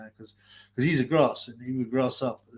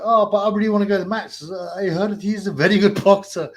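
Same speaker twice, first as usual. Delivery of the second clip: pretend keen customer; 5 semitones higher, 6 dB louder than usual. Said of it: "I was like".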